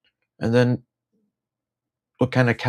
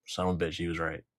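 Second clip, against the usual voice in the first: soft, low, grudging mutter; muttered aside; 3 semitones lower, 13 dB quieter, faster than usual. Acted. Admitting the conspiracy was right, muttered softly. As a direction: mutter it under your breath.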